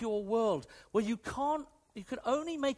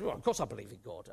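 offhand reply; 10 semitones lower, 4 dB quieter, much faster than usual. Retold or imitated. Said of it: "he may well have said".

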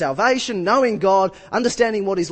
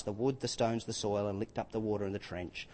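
ordinary talk

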